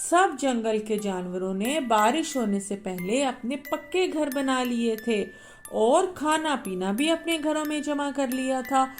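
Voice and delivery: ordinary delivery, nothing marked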